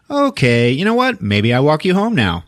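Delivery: exaggerated sing-song retreat from the conversation; humoring brush-off. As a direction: act out humoring someone with a wild story. Retold awkward brush-off after wild story.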